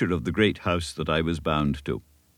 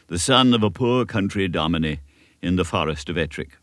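ordinary delivery

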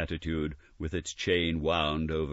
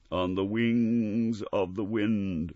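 slow, eerie spectral voice; slow haunting ghost; 5 semitones higher, slower than usual. Acted. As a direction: intone it like a restless spirit. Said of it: "replied the ghost".

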